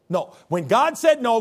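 normal talking voice

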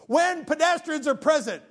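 high, loud shout. Retold or imitated. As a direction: yell the sign's words at him.

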